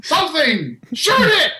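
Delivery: high villager shout breaking into laughter; hammed-up high cry of alarm; panicked townspeople. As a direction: shriek the order, half laughing. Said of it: "You see people".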